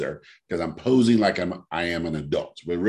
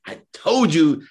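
higher, louder gloating told-you-so; smug; 8 semitones higher, 5 dB louder than usual. Retold or imitated. Gloating at Jennifer that the drink is famous, smug and raised. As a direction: crow it at your friend, mock triumphant. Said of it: "And I'm all smug".

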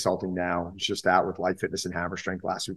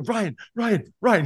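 high excited calls of a name; kids calling out; 12 semitones higher, much slower than usual. Retold or imitated. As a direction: call him over and over, starstruck. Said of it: "They're going".

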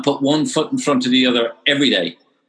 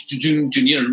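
ordinary talk

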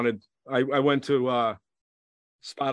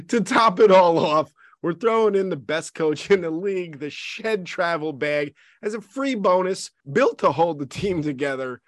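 ad read; 5 semitones higher than usual. Acted. Sports roster ad read.

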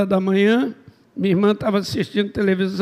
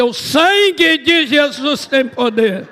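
loud, slow, raised exclamation; retold cry; 4 semitones higher, 4 dB louder, slower than usual. Preacher re-enacting his cry on hearing he'll marry, slow shout. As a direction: cry it out slowly as if warding off danger. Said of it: "me deu um grito".

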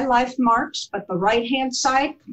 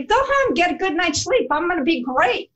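bright, much higher reassuring send-off; cheerful; 7 semitones higher than usual. Retold or imitated. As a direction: send your tearful family home with upbeat cheer. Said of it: "I said to them cheerfully".

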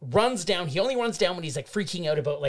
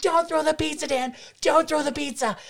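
much higher mock shouting from the crowd; guys shouting; 10 semitones higher than usual. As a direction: bystanders pleading. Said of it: "guys behind the gate going like".

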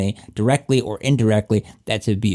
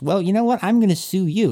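raised-pitch indignant declaration; mock defiance; 7 semitones higher than usual. Accused man announcing a lawsuit, mock defiant. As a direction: act out turning the tables.

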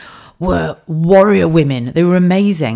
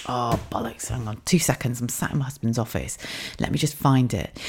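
much softer, lower muttered aside; muttering aside; 4 semitones lower, 9 dB quieter than usual. Real, not acted. Searching her husband's office, muttering softly to herself. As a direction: mutter to yourself while searching.

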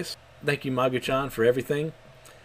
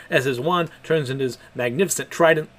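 ordinary talk, nothing marked

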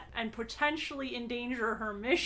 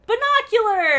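excited shout; 10 semitones higher, 12 dB louder than usual. Real, not acted. Joking correction, shouted.

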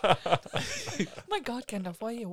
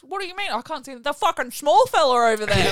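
high, mock-sarcastic dad getting louder at the end; 6 semitones higher, 10 dB louder than usual. Dad sarcastically denying he sees the big dude, rising to loud. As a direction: scoff like a dad mocking the small folk. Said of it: "And Dad goes".

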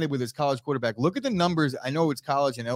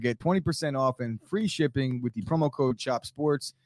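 sponsor ad read; 3 semitones higher than usual. Acted. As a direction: sell the offer hard. Cohost continues sponsor read.

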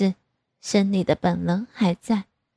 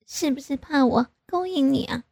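higher-pitched pouty reproach; coy mock scolding; 5 semitones higher than usual. A narrator's reading. Daughter reproaching her father, coyly. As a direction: chide with a pout. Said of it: "我对着他娇嗔道".

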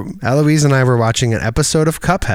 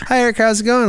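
much higher, bright mock greeting; high chirpy greeting; 12 semitones higher, much faster than usual. Imitating people greeting him, high and chirpy. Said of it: "my voice goes up".

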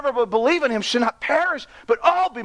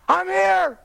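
high ringing call of arrival; groom's shout; 9 semitones higher than usual. Retold or imitated. Groom announcing to bride he's here, high call. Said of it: "he would give a shout".

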